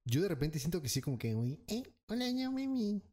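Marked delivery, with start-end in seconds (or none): 1.7-3.0 mock squeaky greeting, pitched well up. Making fun of his own voice greeting a girl, mock squeaky and high. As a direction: lay on the squeak, a nervous guy hitting on a girl. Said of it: "sí es como que medio chillona".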